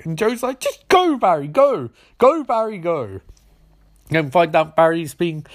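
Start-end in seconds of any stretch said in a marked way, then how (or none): 0.6-3.2 high, insistent shooing; mock-impatient send-off; acted urging. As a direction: urge him out the door, playfully pushy. Joe urging Barry to leave.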